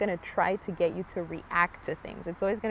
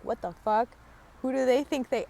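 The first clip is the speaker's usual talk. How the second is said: mock indignant, higher and a bit louder; retold irritated outburst; 5 semitones higher, 3 dB louder than usual. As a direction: replay the flash of road rage, half joking. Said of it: "I could hear my reaction internally".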